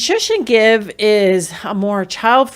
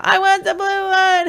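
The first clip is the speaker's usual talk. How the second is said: put-on high, whining child voice; whiny child; 12 semitones higher, 6 dB louder than usual. Child whining at parent for the blue one. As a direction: play the kid demanding the blue one.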